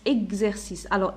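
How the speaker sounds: ordinary delivery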